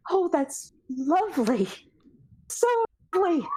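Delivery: a lightly voiced timid Josiah; soft nervous Josiah. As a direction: say it with nervous resignation. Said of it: "soft and nervous".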